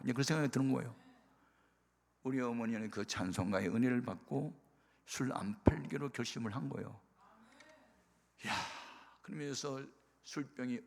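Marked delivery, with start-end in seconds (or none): none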